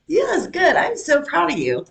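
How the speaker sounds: high-pitched, gushing praise; proud praise. Real, not acted. Praising a partner for right answer.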